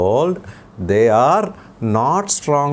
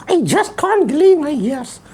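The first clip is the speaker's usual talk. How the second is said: put-on puppet boy voice; 12 semitones higher than usual. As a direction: play the cheeky little boy puppet. Puppet child voice.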